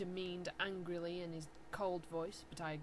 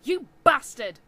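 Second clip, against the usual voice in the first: loud, higher angry yell; angry shout; 4 semitones higher, 16 dB louder than usual. Acted. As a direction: hurl it at him furiously. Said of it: "I shouted angrily".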